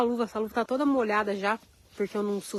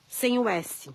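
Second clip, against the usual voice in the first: ordinary delivery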